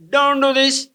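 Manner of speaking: put-on firm loud scolding at the children. Shown as a demonstration. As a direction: raise your voice to stop the children. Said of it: "raise your".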